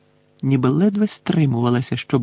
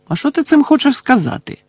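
high-pitched indignant retort; taking offence, voice shooting higher; 11 semitones higher than usual. A narrator's reading. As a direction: challenge it, offended, pitch jumping up. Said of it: "обурився Рон".

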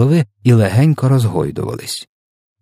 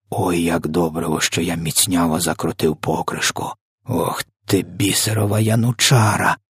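plain reading voice

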